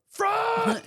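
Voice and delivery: very high, loud mock cry of France!; mock shout. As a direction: wail France! in mock longing. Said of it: "they go".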